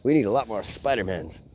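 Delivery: ordinary delivery, nothing marked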